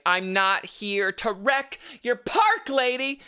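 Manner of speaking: high, slow, hammed-up sweet reply to the lady; mock polite retort. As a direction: stretch it out, high and sugary. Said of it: "I didn't say it like that".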